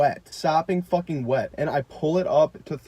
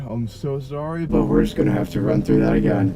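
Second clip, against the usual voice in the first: overhead announcement; 3 semitones lower, 5 dB louder than usual. Retold or imitated. Staff announcing a rerun over the overhead, mock PA voice. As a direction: play the apologetic announcer over the speakers. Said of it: "they go over the overhead".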